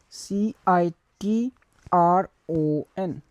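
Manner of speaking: ordinary talk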